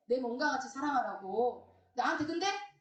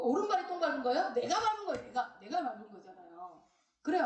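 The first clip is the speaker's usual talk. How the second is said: ordinary talk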